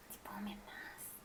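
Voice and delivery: whispered doubtful question; queen doubts the tree next door, whispering. Acted. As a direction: breathe it under your breath.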